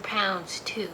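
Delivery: breathed out in a hushed whisper; whispered punchline. Acted. Reading granny's naughty brag, whispered.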